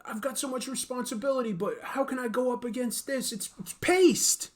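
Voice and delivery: high, fretful hero voice; whiny Spider-Man. Acted. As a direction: whine like a worried superhero. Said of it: "Spider-Man going".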